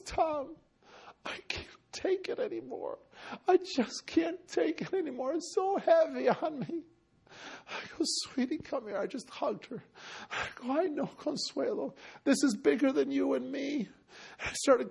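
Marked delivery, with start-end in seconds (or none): 0.0-6.8 higher, much softer, near tears; tearful plea. Retold or imitated. Consuelo telling the preacher she can't go on, tearfully. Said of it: "She starts crying". 8.0-13.9 comforting. Retold or imitated. Comforting the crying woman.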